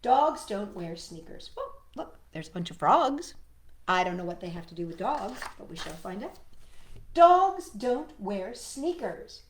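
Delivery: ordinary delivery, nothing marked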